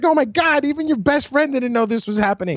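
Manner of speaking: high-pitched acted astonishment; J R shocked. Announcer reacting to his friend's betrayal, shocked. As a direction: imitate a stunned commentator. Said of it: "even J R was shocked".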